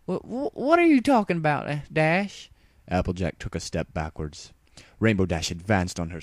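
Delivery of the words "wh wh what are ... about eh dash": high, flustered stutter; stammering denial. A narrator's reading. Applejack stammering back at Rainbow, high and flustered. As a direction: backpedal nervously.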